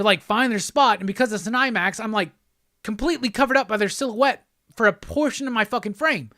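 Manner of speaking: normal talking voice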